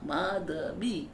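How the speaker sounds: slow माधवी, maa-dha-bee; syllable-by-syllable name. Shown as a demonstration. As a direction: separate माधवी into three clear syllables. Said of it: "नहीं".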